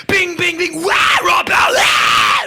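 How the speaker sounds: loud sound effects into a raucous scream; imitated frantic show. Song after song, [name] screaming wildly. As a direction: bings bursting into a rock yell.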